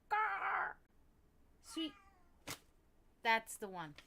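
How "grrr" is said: higher mock growl; imitating cat growl. Imitating the annoying noise her cat makes. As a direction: mimic the cat's grumble. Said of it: "when she's like".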